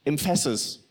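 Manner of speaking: emphasis said em-FASS-is, middle syllable stressed; misplaced stress. Retold or imitated. Father imitating his son's speech, emphasis stressed on the wrong syllable. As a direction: put the weight on FASS in emphasis. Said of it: "he really battles with".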